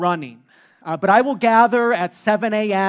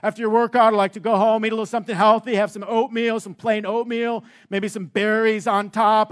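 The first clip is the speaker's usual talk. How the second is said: imitating a health-nut trainer, pitched up; high, earnest gym-guy health tip; Jeremy; 3 semitones higher than usual. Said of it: "that's how he talks".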